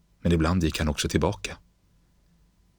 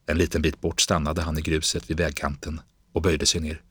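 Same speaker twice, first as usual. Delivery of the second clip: ordinary delivery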